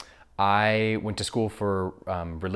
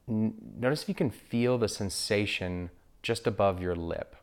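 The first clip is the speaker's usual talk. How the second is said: guided meditation. Acted. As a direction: guide listeners gently into breath awareness, unhurried.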